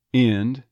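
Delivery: "and" said as "ind"; "and" with the vowel of "in". Shown as a demonstration. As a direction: raise the A in "and" towards a short I. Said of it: "raise up towards the I".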